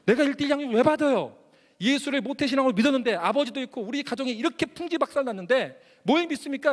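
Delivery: 높고 크게 쏘아붙이기; 억울함을 터뜨리며 따져 묻기. Retold or imitated. 어머니에게 따지는 아들. (어머니에게 따지는 아들, 격한 항의)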